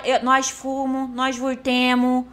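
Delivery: "nós fumo, nós vurtemo" drawn out slowly, folksy r in "vurtemo"; wrong grammar on show. Shown as a demonstration. Admitting her own mistakes to the group, deliberately botched "nós fumo, nós vurtemo". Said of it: "Eu falo tudo errado".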